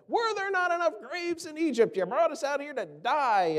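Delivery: high mocking whine, sarcastic grumbling; whiny complaint. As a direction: mimic the grumblers, pitch up. Preacher acting complaining Israelites.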